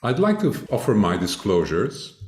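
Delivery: usual speaking voice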